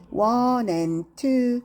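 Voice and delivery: counting each vowel very slowly; teacher counts vowels slowly for pupils. Shown as a demonstration. Very slow counting aloud. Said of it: "vowel을 찾아야 돼요".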